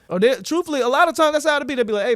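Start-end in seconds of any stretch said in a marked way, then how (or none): 2.1-2.2 raised, higher-pitched call-out; louder. Acted. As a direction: call out so they hear you. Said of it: "like, louder".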